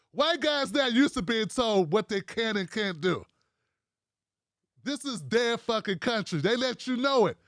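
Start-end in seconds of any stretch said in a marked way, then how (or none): none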